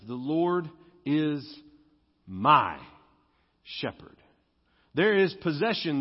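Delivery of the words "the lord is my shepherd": slow, "my" dropped low and punched, "shepherd" breathed out; stress on my. Shown as a demonstration. Pastor teaching the possessive, slow stress on "my". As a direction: linger and land hard on "my". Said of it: "The possessive".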